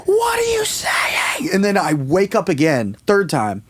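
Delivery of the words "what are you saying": very high, drawn-out strained screech; mock scream, slow and piercingly high; nightmarish shriek. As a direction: imitate a nightmare mob, stretching every word. Nightmare family screams at him.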